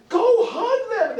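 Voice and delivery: high, loud, slow insistent command; urging parent. Retold or imitated. Parent pushing a scared child to hug a relative, high slow call. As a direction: push the kid toward the relative.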